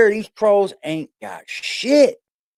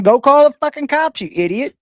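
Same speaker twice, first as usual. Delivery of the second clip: exasperated yell; 9 semitones higher than usual. Real, not acted. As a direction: tell him off, voice up. Yelling at the troll.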